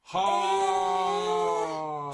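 surprised. Real, not acted. Host reacts to behind-the-scenes story.

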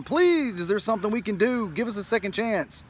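desperate mock plea fading away; begging. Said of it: "crying and begging".

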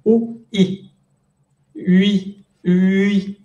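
"U, U, I" gliding into "ui"; vowel drill. Shown as a demonstration. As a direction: slide the lips from U to I. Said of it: "tienen que decir".

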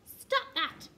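high, loud sharp command; quacked order. A narrator's reading. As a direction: quack out a bossy telling-off. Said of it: "he quacked".

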